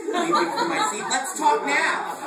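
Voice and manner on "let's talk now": slow, high, loud laughing demand; hammed-up furious shout. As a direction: bellow it out while laughing. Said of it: "I cried".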